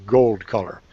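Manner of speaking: ordinary delivery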